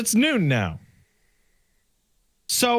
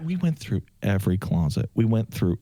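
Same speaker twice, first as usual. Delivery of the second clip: stunned disbelief; 9 semitones lower than usual. Retold or imitated. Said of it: "I'm like".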